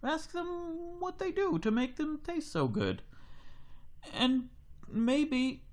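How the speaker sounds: a dad patiently explaining, high and slow. Acted. Dad voice in retold story.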